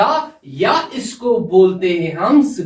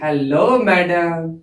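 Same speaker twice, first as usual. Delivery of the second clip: slow, drawn-out hellos; put-on character voice, slow and drawn out; Shanu greeting; slower than usual. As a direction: stretch each greeting out playfully as an acted character. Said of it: "ये शानू इसको बोलती".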